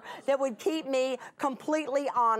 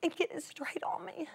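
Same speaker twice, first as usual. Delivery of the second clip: whisper; 7 semitones higher, 6 dB quieter than usual. Real, not acted. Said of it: "nearly had a panic attack".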